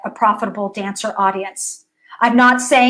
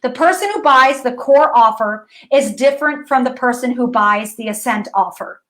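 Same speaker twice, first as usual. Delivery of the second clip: ordinary talk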